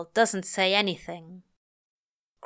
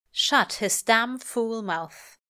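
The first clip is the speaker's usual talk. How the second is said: plain reading voice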